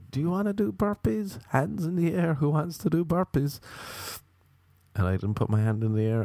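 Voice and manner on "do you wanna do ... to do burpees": shrill high yelling fitness coach; yelling instructor. Retold or imitated. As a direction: bark it like a hot yoga drill instructor. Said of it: "he was like".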